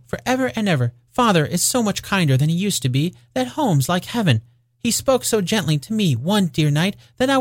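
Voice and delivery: put-on high, light child's voice; gleeful little girl. A narrator's reading. Little sister tells brother Father is kinder, gleeful. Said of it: "brimful of glee".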